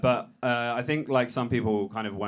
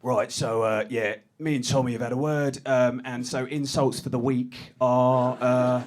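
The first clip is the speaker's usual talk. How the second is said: higher, louder, slow laid-back writers' room lead voice; pretend sketch writer; 5 semitones higher, 5 dB louder, slower than usual. Tommy's partner reading the week's insult list, higher and louder. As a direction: play a slick boss listing the week's insults. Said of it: "acted writers' room boss".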